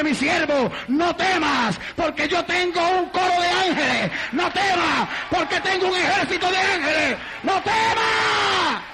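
prophetic cry building to a high shout; prophesying. Retold or imitated. Sister prophesying to God's frightened servant, building prophetic cry. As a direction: proclaim it, stretching the last words long. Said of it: "sale corriendo en profecía".

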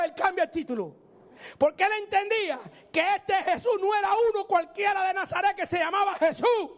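ordinary talk, nothing marked

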